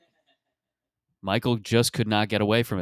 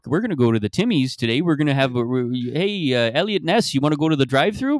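put-on Canadian accent; 5 semitones higher than usual. Voicing Canadian cop at Timmy's.

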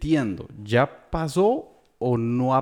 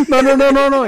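high, loud laughing no; 12 semitones higher, 11 dB louder than usual. Real, not acted. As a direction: laugh it off loudly. Laughing denial. (negando entre risas)

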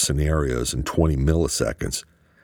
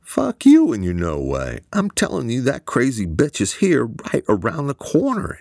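annoyed outburst; 10 semitones higher than usual. A narrator's reading. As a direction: snap back at a doubting friend. Eamon irritated on the phone.

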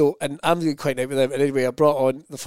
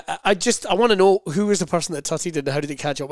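ordinary talk

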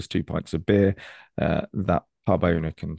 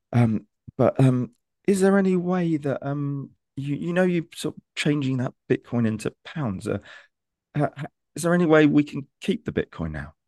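much higher, tentative imitated asking voice; hesitant restaurant owner; 9 semitones higher than usual. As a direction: ask shyly, as the owner feeling his way. Restaurant owner asks to keep Bitcoin.

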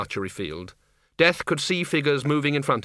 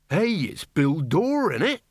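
higher, slow hearty yokel; cheery villager; 5 semitones higher, slower than usual. Acted. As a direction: greet him like an old mate. Villager greets loudly.

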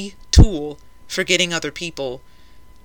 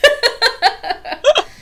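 high-pitched laugh; 6 dB louder than usual. Real, not acted. Cracking up at own joke.

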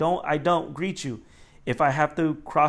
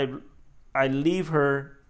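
usual speaking voice

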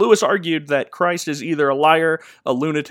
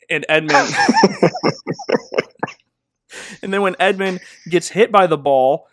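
normal talking voice

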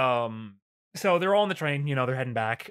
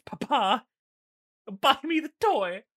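put-on high, whining child begging papa; squeaky spoiled child whining for a toy; whiny bratty kid; 10 semitones higher, slower than usual. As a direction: beg like a spoiled little kid.

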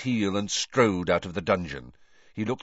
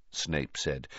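ordinary delivery

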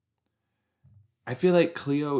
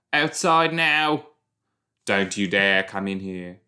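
firm order out; 4 dB louder than usual. Real, not acted. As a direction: send them out, firm and loud. Shooing someone out of room.

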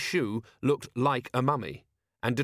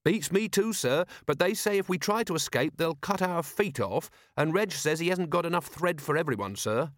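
put-on earnest young guard, a touch higher; eager, dutiful young officer; young watchman voice; 7 semitones higher than usual. Carrot voiced by narrator.

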